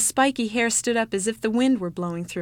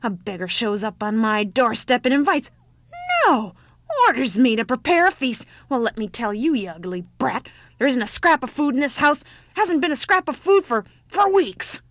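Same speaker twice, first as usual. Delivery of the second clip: put-on loud old woman's tirade, pitched up; screaming outrage; 4 semitones higher, 7 dB louder than usual. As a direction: rant indignantly, building to a high shout. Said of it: "screamed the old woman".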